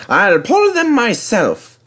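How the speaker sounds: high, slow, determined; determined engine. A narrator's reading. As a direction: insist on doing it alone. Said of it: "answered James".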